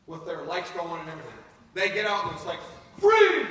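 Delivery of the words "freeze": loud, high barked freeze; cop's shout. Retold or imitated. A cop orders the suspect to stop, shouting. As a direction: yell it like a cop with a gun drawn.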